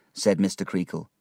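plain reading voice

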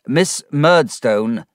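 loud, slow, commanding interruption; said loudly. Acted. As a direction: cut in firmly, drawing out the name. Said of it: "Mister Spenlow said loudly".

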